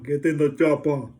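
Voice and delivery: loud, lightly Austrian action-hero command. Retold or imitated. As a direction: bark it like a movie hero. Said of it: "Every film that he's ever done".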